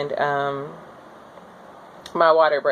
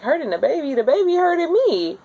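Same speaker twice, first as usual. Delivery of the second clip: high, rising disbelief; incredulous retort; 11 semitones higher than usual. Retold or imitated. Laboring mother scoffing at the doula's warning, high and incredulous. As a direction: throw the words back in disbelief.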